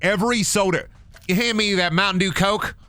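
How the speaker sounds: high-pitched put-on Southern accent; mock Southern. Southerner asking for a Coke, mock Southern accent. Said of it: "Southern people for saying Coke".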